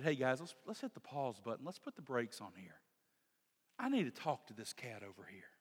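easy, relaxed, like a buddy; casual Jesus. Retold or imitated. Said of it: "He said".

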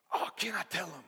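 high, loud, eager outburst; straining squeal of a hammed-up eager volunteer; bursting to tell. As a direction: beg excitedly for the chance to speak. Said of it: "And the rocks are just going".

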